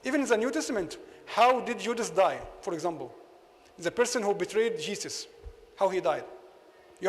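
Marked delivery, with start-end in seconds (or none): none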